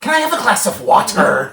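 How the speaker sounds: high, loud, laughing put-on voice; mock delivery. Podcaster mocking a Jaws character's delivery, high laughing put-on voice. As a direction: ham up the line through laughter.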